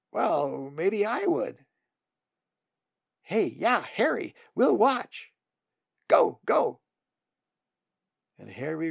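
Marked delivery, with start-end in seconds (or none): 0.1-1.7 higher chick voice, tentative; a chick daring to try; hesitant volunteer. Acted. Brave nestling volunteering. 3.2-6.8 very high, loud chick cheering; excited egging on. Acted. Siblings egging Harry on.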